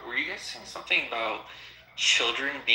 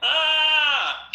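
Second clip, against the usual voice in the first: sudden high loud shriek; 13 semitones higher, 7 dB louder than usual. Real, not acted. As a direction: let out a sharp startled yelp. Mock shriek at insult.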